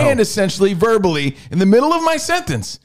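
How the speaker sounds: angry rant at his co-host; exasperated shout. Real, not acted. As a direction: vent at him, fed up. Heated quarrel on air.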